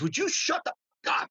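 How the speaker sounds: high-pitched mock yell without much volume; retold yell. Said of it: "and she yelled at me".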